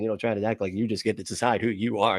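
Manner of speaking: normal talking voice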